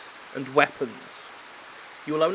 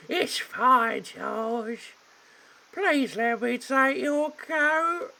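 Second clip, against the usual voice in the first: maid voice; 9 semitones higher, much slower than usual. Acted. Maid calming the butler, falsetto. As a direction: chirp it out sweetly. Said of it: "apparently I will for her".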